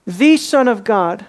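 slow, "The" hit hard; stress on THE. Shown as a demonstration. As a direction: drag it out and lean on "The". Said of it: "Underline that word".

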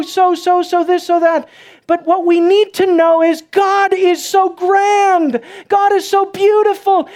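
high, loud preaching climax; full-force preaching. Acted. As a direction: preach it out to the church.

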